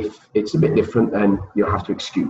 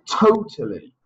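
Totally with the first syllable TO hit high; stress on TO; 9 semitones higher than usual. Shown as a demonstration. Teacher showing syllable stress on Totally, first syllable high. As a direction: punch TO in Totally. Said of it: "Where's the syllable stress?".

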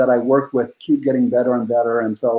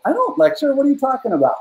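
much higher, playful protest; mock indignant denial; 11 semitones higher, faster than usual. Dad to daughter, mock offended denial, pitched way up. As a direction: act shocked at the accusation, tongue in cheek.

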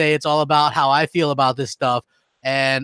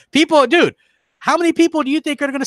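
excited outburst; 10 semitones higher, 3 dB louder, faster than usual. Real, not acted. Rant peaks about Microsoft.

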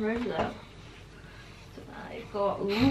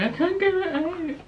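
high, louder mock whine of struggle; imitating herself struggling; 9 semitones higher, 4 dB louder than usual. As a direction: replay her own frustrated whine. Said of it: "that was, like, me for, like, a minute just going".

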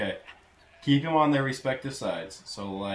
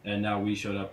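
normal talking voice

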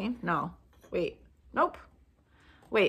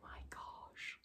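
very low, hushed whisper of amazement; awed whisper; 26 dB quieter than usual. Real, not acted. Vlogger seeing a viewer's gift, awed whisper. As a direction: whisper it, stunned.

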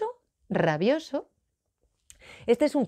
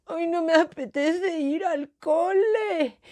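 slow, higher, drawn-out whine; whining little Moncho; 7 semitones higher, slower than usual. Acted. Moncho refusing school to his mum, whining. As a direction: whine it out like a reluctant child. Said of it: "arrugaba la cara y decía".

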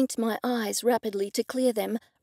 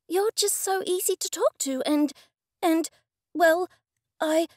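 high, pleading woman's voice; anxious, trailing away; 6 semitones higher than usual. A narrator's reading. Rebecca begs Sybil to keep a secret, anxious and faltering. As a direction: plead nervously, then falter into broken pieces. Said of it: "her voice trailed away".

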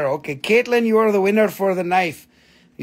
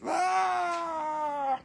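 high shriek; witch shriek; 9 semitones higher, 9 dB quieter than usual. Pretend. Flying the crystal past the camera with a high shriek.

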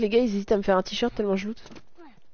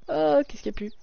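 ordinary talk

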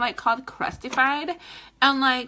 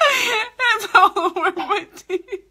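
higher, slow, breaking into laughing sobs; laugh-crying; 8 semitones higher, 6 dB louder, slower than usual. Pretend. Lipstick on her teeth, she laugh-cries higher. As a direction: let the laughter crack it like crying.

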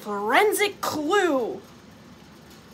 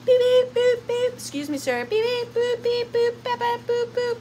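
high monotone electronic beeping; imitated metal detector beeps; 10 semitones higher, 3 dB louder than usual. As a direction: beep like a detector, pitched way up. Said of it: "I'm gonna be like".

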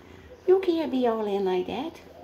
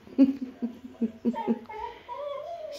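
high squealing laugh; 14 semitones higher than usual. Real, not acted. Owner laughing at her own dog joke. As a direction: giggle up high, near tears.

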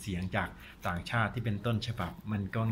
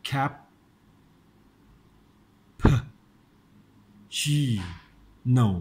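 very slow, Cap, p, chi, no, with long gaps; slow syllable demo; 2 semitones higher, 6 dB louder, much slower than usual. Shown as a demonstration. Teacher breaking cappuccino down for learners, slow syllable demo. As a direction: stretch it out syllable by syllable. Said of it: "เพราะฉะนั้นคำนี้ช้าๆนะ".

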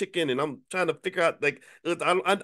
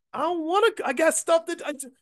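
high whiny reluctance. Retold or imitated. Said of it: "It's like".

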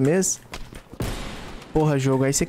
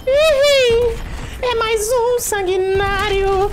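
high, drawn-out hype call; hammed-up excitement; 4 dB louder, much slower than usual. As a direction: sing it out showing off. Hyped announcing another kill.

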